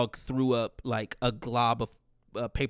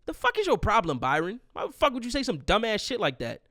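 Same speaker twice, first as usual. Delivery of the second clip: exasperated scolding; 7 semitones higher than usual. Pretend. Scolding absent dad for tactless remark.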